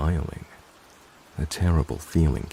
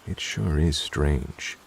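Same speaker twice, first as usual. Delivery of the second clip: usual speaking voice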